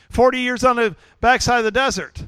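ordinary talk, nothing marked

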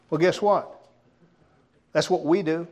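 ordinary delivery

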